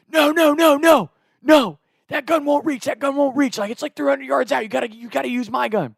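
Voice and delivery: high, urgent mock panic; imitating urgent brother. Brother stops him using the wrong gun, urgent.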